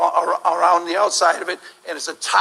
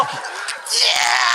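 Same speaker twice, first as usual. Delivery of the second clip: strangled silent scream. Acted.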